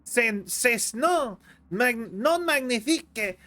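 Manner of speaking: mock French accent, slowed to get it right; retry the line in careful stage French; French accent, carefully slowed; slow corrected French accent. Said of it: "I said that wrong".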